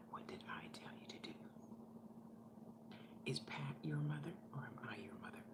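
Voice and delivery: quick, hushed scolding whisper; mother's fast angry whisper. Retold or imitated. Mother scolding daughter over Pat, in a fast whisper. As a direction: hiss the questions, never raising the voice.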